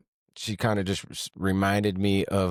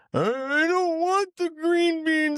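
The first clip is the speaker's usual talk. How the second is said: put-on very high, slow whiny child voice; whiny little kid. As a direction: whine it like a fussy little kid. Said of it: "and she's like".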